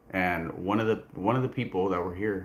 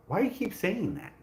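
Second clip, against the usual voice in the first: exasperated retort; 12 semitones higher than usual. Retold or imitated. Quoting herself, annoyed.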